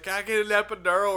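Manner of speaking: high, loud panicked plea; woman in labor. Pretend. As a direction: beg like you're mid-contraction. Acting out giving birth.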